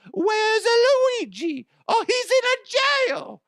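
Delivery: piercingly high cartoon Italian; loud falsetto mock Italian accent; Super Mario. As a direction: squeal it as a cartoon plumber. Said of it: "sounds like Super Mario Brothers".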